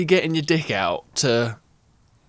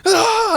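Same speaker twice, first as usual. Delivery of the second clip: high loud mock yell; retold roar; 5 dB louder than usual. Friend mimicking Greg's roar, loud mock yell. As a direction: shout it out like a raging lifter. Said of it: "he went".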